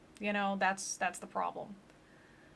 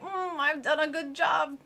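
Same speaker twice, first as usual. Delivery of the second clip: smug grandma; 6 semitones higher than usual. Retold or imitated. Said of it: "the grandma's like".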